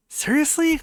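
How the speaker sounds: high, incredulous seriously; stunned squeak of disbelief; surprised. Acted. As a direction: shoot up in disbelief. Reacts to a wedding crasher.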